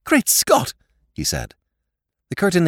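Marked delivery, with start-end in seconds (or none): 0.0-0.7 high, jolted outburst; startled exclamation. A narrator's reading. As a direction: jump at the sight. Jimmy visibly jumps in surprise.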